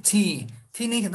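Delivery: normal talking voice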